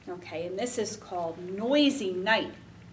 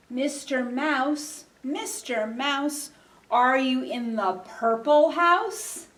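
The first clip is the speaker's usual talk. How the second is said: slow, raised game call; playful chanted question, louder at the end; sing-song calling; 3 semitones higher, 5 dB louder than usual. Pretend. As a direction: call out slowly to a hidden mouse, lifting at the end. Chanted call to hidden mouse.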